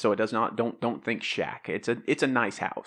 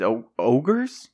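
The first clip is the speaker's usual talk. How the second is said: alarmed surprise; 2 semitones higher, 8 dB louder, much slower than usual. Acted. Father alarmed at ogre news.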